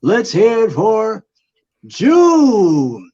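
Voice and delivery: slow ring announcer, rising high and loud; boxer announcer voice. Pretend. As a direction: build the name into a big high shout. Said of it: "boxer announcer voice".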